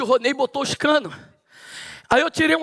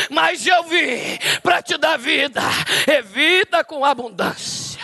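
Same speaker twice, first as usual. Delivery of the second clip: fervent preaching; 4 semitones higher, slower than usual. Acted. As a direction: hammer each phrase to the church. (pregação fervorosa, lenta e enfática)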